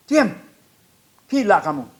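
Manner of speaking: high, loud angry order; retold soldier's shouting. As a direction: imitate a soldier yelling at singers. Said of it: "bentak-bentak tentara".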